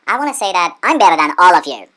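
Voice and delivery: I'm punched loud in a boasting line; stress on I'M. Real, not acted. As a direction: hit "I'm" hard and loud. Teasing boast at viewers.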